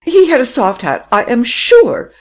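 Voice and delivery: high, loud, insistent woman's voice; insistent lady. Acted. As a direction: press the point stubbornly. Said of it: "repeated Madame Renaud".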